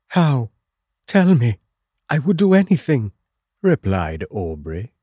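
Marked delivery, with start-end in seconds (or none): 0.1-3.1 high, quick, eager; urgent eager plea. Acted. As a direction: rush to offer help, pitched up. Aubrey's urgent reply to a dying friend.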